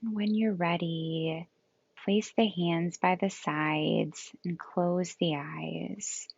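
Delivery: calm yoga nidra guidance with long, restful pauses; guided meditation. Acted. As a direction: gently lead them into rest, leaving space between phrases.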